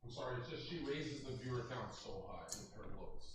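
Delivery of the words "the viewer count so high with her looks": low, hushed whisper off mic. Real, not acted. Telling the co-host about the guest, whispered. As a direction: say it under your breath.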